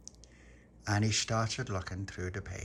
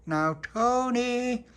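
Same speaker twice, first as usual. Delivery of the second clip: high, loud, drawn-out call; shouting through letterbox; 14 semitones higher, 6 dB louder, much slower than usual. Acted. They shout through Tony's letterbox, calling him out. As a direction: holler the name slowly, way up high. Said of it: "shouted through the letterbox".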